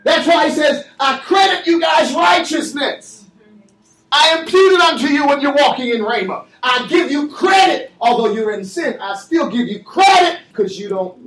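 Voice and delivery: full-throated pulpit climax; preaching at full force. Acted. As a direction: whip the church into amens. Shouting sermon climax.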